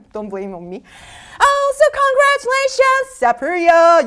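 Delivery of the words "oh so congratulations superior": high, loud congratulations; excited congratulations. Real, not acted. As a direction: cheer for the winner. Congratulates a quiz winner.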